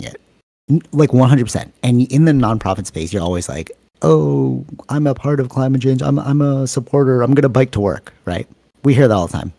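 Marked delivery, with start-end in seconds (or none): 4.0-8.1 higher-pitched mimicry of eager supporters; mock earnest supporter. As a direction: parody an eager do-gooder, pitched up. Mimicking self-styled climate supporters.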